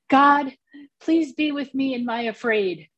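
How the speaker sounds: put-on high, earnest small-child voice; retelling a small boy's bath-time plea in his high voice; little boy's prayer. Quoting her three-year-old nephew's prayer.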